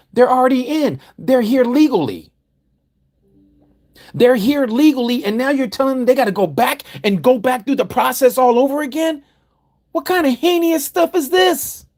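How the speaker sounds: high, loud indignant preaching; outraged. Real, not acted. Preacher railing at the congregation over deporting legal migrants, outraged. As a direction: cry out against the injustice, loud and high. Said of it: "outraged preaching".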